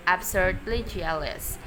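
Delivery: ordinary delivery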